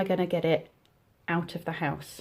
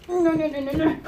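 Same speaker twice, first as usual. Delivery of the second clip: panicked; 7 semitones higher than usual. Real, not acted. Spider moving again.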